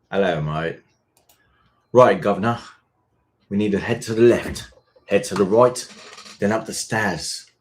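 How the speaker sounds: put-on British accent. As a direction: try a cheeky British voice.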